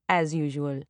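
dropped low and dry; deadpan self-mocking echo; wry low aside. A narrator's reading. Wry repeat of as usual.

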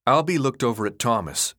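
plain reading voice

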